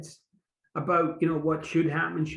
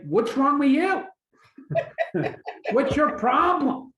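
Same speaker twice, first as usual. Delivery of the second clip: higher, louder, half-laughing accusation; mock irritated snap; 8 semitones higher, 5 dB louder, slower than usual. To a loved one, frustration comes out as a mock snap. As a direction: fire it at them as a mock complaint. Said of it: "but it comes out like".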